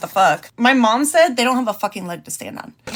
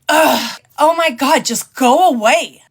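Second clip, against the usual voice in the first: louder, exasperated mock groan; imitating annoyed fiancée; 3 semitones higher, 5 dB louder, slower than usual. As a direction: brush him off, fed up and loud. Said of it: "And then she's like".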